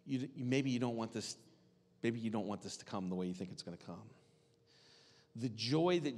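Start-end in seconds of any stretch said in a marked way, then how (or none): 0.0-4.1 gentle warning. Retold or imitated. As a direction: lower and softer, faster at the end. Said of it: "He's trying to gently warn her".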